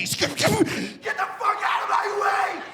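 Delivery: high, stretched mock scream; freaking out. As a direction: snap into a panicked squeal, dragged out. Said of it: "I'm gonna freak out".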